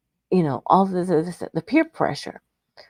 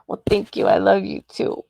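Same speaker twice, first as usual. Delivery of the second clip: ordinary talk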